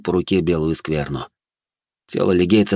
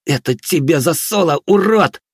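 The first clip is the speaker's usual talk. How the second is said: high, forceful exhaled curse; vengeful satisfaction; 8 semitones higher, 3 dB louder than usual. A narrator's reading. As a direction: spit out a payback, louder and higher on the insult. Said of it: "выдохнул Алис с удовлетворением".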